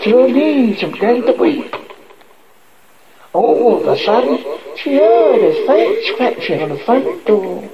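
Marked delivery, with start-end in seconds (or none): none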